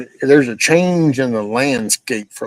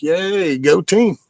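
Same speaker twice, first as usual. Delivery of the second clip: a higher, mock cheer; sarcastic cheer; 8 semitones higher, much slower than usual. Griping about his Medicare going up, a sarcastic mock cheer.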